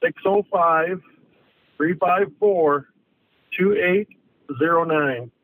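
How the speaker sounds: slow and clearly spaced digits; over enunciating. Shown as a demonstration. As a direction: spell out each number slowly. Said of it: "Repeat that one more time, slowly".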